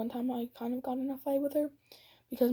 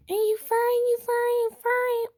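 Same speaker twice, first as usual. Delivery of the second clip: much higher, squeaky mock taunt; 11 semitones higher, 5 dB louder than usual. Mocking the girl who taunted her, high-pitched. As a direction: mimic her sneering, high and silly.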